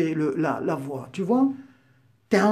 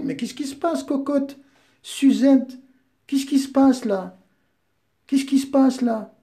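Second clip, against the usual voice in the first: mock pity; 6 semitones higher than usual. Mock-comforting the opponent as a girl.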